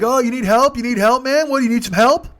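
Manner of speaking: high, loud teasing offer of help; loud mock concern from jeering teens; mocking teenagers. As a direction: tease him like a smirking kid in the lot. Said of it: "Some of them are like".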